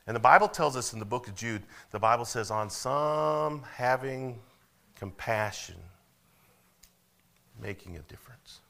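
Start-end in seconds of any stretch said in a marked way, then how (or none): 2.5-8.6 solemn slow scripture reading; slow scripture quote. Acted. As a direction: read the verse slowly and deliberately from the pulpit. Quoting scripture from Jude.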